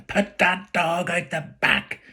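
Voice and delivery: high, slow shouted order; a mock angry mother barking orders; mother shouting. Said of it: "Ma really shouts".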